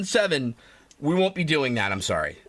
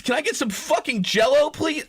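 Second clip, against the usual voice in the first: shouted demand; 6 semitones higher than usual. Retold or imitated. Repeats the Jell-O demand, corrected.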